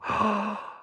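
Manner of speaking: sharp, high intake of breath; amazed gasp. Real, not acted. Streamer hearing of a real-life Stars Hollow, amazed gasp.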